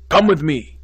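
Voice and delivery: loud, high, impatient command; sharp order. Acted. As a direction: snap him into moving. Dean orders student.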